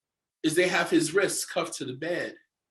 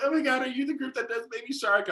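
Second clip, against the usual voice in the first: put-on higher starstruck girl asking; excited fan girl; 8 semitones higher than usual. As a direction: gush like a fan meeting a star. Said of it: "She was like".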